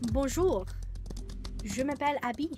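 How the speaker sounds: put-on trying out a French accent; playful French accent. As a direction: show off a little French accent. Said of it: "A French accent is very cool".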